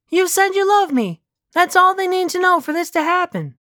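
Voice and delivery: put-on high-pitched girlfriend voice; high character voice, pleading her case; insistent Elena. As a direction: confront a lover in a high, put-on voice. Plays Elena confronting Patty.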